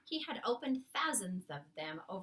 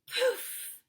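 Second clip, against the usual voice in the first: sudden loud puff, much higher; puff of breath; 10 dB louder than usual. Acted. Giant blowing the dream into the room, puffing loudly. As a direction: blow it out hard like a gust.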